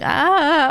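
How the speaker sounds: sudden projected high vowel. Shown as a demonstration. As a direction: push out a high, loud "ah". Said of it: "feel the need to".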